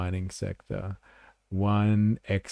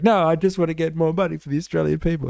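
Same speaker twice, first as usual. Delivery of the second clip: higher, fast, squeaky defensive voice; mock meek greenie reply; 8 semitones higher, 3 dB louder, faster than usual. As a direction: rattle it off like a flustered innocent. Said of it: "And he's like".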